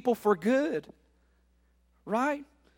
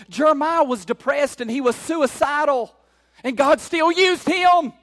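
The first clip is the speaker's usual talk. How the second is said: loud, emphatic sermon cadence; rousing preacher with drawn-out key words; full-force preaching; 5 semitones higher, 7 dB louder, slower than usual. Real, not acted. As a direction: drive each example home to the congregation. Preaches a rising list.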